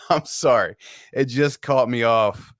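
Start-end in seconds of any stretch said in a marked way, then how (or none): none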